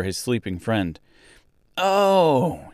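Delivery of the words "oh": high, drawn-out "oh" of sudden realization; sudden recollection; exclaiming. A narrator's reading. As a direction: exclaim as it dawns on you. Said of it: "he exclaimed".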